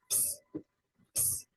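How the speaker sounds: "ps" with no vowel, a short hissed p-s; isolated letter sound. Shown as a demonstration. Teacher sounding out psi, bare voiceless "ps". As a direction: pop the p straight into s: "ps". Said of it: "the sound it makes is".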